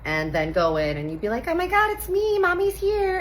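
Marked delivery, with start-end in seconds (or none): 1.5-3.2 very high, excited squeal; gushing mom greeting. Retold or imitated. Mom at preschool pickup greeting her kid, high excited squeal.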